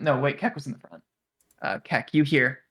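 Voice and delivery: ordinary talk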